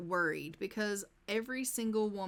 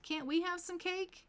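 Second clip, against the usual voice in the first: high, pitched-up pleading dog; put-on high voice for pleading dogs; begging dog voice; 9 semitones higher than usual. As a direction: beg like a hungry pet. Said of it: "the dogs are just sitting there like".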